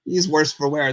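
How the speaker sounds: ordinary talk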